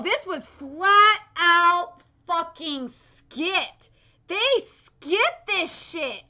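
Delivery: slow, loud, stretched-out outrage at flat; real indignant drawl; drawn-out disbelief. Real, not acted. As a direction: drag every word out in shock. Stunned at skipped manga scene.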